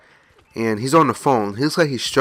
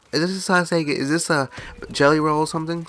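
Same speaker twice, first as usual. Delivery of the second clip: higher, softly mumbled questioning; pestering older man; 5 semitones higher, 3 dB quieter than usual. Retold or imitated. Man keeps asking what the food is, mildly mimicked. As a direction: lightly mimic a bothersome old customer.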